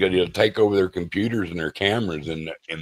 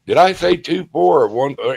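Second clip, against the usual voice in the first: raised, drawn-up teasing question; mock questioning; 8 semitones higher, 4 dB louder than usual. Caller needling someone over the numbers, mock questioning. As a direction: put on a higher voice to quiz them.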